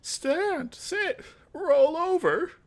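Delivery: put-on high, slow old man commanding wolves; old mage scolding his wolves, high and slow. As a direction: bark orders like a fussy old mage. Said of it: "I have to get this voice right".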